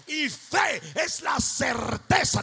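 loud, ringing preacher's cadence; fiery sermon delivery; preaching at full force. Acted. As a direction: drive the faith message home. Preaching about prayer and faith.

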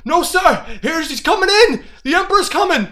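high, loud frantic cry; panicked yell. Acted. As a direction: shout the warning in alarm. Panicked dock worker calls.

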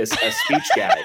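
high, slow words broken by laughter; laughing. Real, not acted. Mocking Van Damme's accent to friends, laughing.

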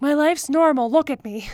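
hammed-up look-at-me voice; mock show-off. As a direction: play up the normal life as a joke. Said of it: "this is me maybe trying to be like".

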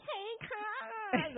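high mock yell across a club; imitating a yell. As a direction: call out to the room like country family. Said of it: "they're yelling in the club like".

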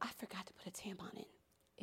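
slow, soft, unvoiced whisper; whispered confession. Real, not acted. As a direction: whisper it like an embarrassing secret. Gasps, then a private confession.

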